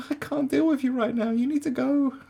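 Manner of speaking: high, loud brush-off; exasperated dismissal. Retold or imitated. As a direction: shoo them away in disbelief. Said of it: "I'm like".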